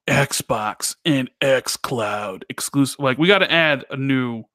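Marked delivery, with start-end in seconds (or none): 0.1-3.0 slow, booming fighting game announcer. Pretend. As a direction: drag each word out like an arena announcer. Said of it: "our announcer voice".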